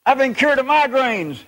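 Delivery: high excited holler from the crowd. Retold or imitated. Woman in the crowd shouting her healing. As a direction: call it out like a cured believer. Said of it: "the woman stood up and hollered".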